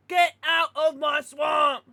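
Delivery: slow, loud mock ogre shout. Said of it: "Just talk like Shrek".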